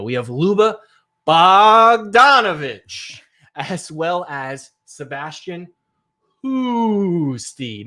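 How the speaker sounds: big drawn-out arena announcer introduction; NBA announcer. Acted. Host introduces the next session's dynamic duo as an NBA announcer. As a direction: boom and stretch the surnames like an NBA announcer. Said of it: "sound like an N B A announcer".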